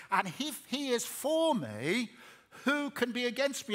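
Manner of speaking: preacher asks his flock who can stand against them, loud and rising; rising rhetorical challenge. Acted. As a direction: hammer the question to the congregation.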